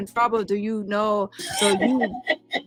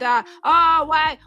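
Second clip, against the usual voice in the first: loud library patron; 6 semitones higher, 4 dB louder, slower than usual. Pretend. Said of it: "They say I'm too loud".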